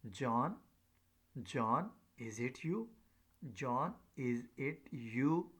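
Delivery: slow "John, is it you?" with a clear rise on "you"; rising question tune. Shown as a demonstration. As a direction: let "John, is it you?" climb slowly and rise at the end.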